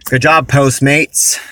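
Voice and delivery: slow, dry sarcastic praise. Real, not acted. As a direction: drag out mock praise sarcastically. After the company failed customer.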